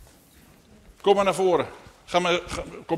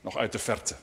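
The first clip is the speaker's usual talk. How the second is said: measured read-aloud narration; story narration; 3 dB quieter than usual. A narrator's reading. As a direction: tell it unhurriedly to children. Reads a story to children.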